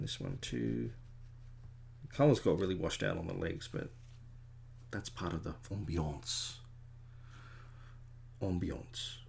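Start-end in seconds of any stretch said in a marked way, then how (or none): 5.7-9.2 ambiance said the French way, om-bee-ONCE; softly affected French vowels on ambiance; posh French pronunciation. Real, not acted. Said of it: "saying it in a very, uh, posh, stupid way".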